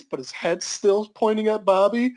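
ordinary delivery

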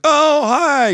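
high, bright surprised greeting; a driver turning round, instantly nice; friendly surprise. Retold or imitated. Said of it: "He's like".

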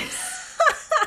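very high giggle; squeaky burst of giggles; helpless laugh. Real, not acted. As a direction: break into high laughter mid-confession. Laughs confessing undercooked pork.

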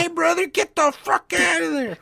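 very high, hammed-up New York voice; over-the-top New Yorker, pitched sky-high; mock New York accent. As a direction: squawk it like a cartoon New Yorker. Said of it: "that's how they speak in New York".

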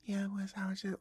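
high, soft, whispery man's voice; soft mock falsetto murmur. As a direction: imitate a tiny hushed high voice. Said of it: "he was talking like [name]".

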